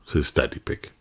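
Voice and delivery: plain reading voice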